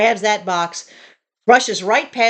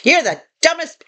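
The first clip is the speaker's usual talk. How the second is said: high-pitched mock berating; exasperated, mocking imitation of a tirade; retold going off; 6 semitones higher than usual. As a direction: imitate someone ranting insults. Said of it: "he's going off on me".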